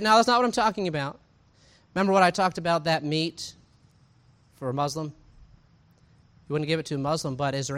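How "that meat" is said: ordinary talk, nothing marked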